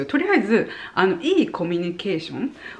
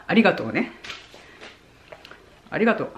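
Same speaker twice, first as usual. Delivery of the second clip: grudging ありがとう; nasty thanks; 3 dB quieter, much faster than usual. Shown as a demonstration. Demo of thanking someone in a nasty tone, sullen. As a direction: say ありがとう like you resent it. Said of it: "嫌な感じでいい言葉言ってみます".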